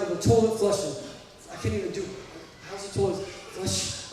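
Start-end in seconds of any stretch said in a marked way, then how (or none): none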